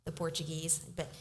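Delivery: usual speaking voice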